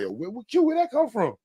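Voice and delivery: high, incredulous quote of himself; acting out his own disbelief; mock surprise. To viewers, retelling his own surprised reaction. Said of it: "I'm like".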